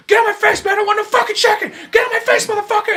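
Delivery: high, loud yelling; mock furious shout. Veteran screaming at the chicken seller, high furious shout.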